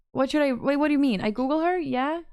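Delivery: usual speaking voice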